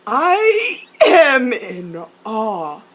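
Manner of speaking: loud, drawn-out wail; overwhelmed wail. Real, not acted. Viewer ranting about a show's plot, overwhelmed wail.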